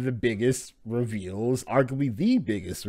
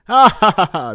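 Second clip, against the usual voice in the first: high loud cartoon laugh; hammed-up loud laugh; Luffy laugh; 9 semitones higher, 10 dB louder than usual. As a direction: laugh like the hero.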